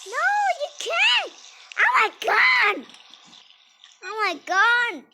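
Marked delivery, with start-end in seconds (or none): 0.1-1.3 squeaky high no; high mocking denial; mock high refusal. 1.8-2.8 put-on high pleading little-kid whine; high, louder childish whine; whiny little kid.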